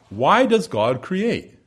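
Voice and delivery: a deliberate, drawn-out question; slow question. Acted. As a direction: ask it slowly and fully, stressing why. Preacher poses rhetorical question.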